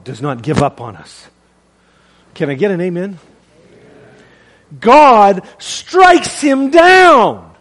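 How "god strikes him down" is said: loud, drawn-out proclamation; dramatic preaching shout. Real, not acted. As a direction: thunder it out very slowly, pitched up. Preacher dramatises God striking Saul.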